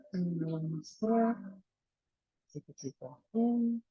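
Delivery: calm guiding voice with long pauses for breath; guided body scan meditation. Acted. Teacher bringing awareness to the toes and heels, guided meditation.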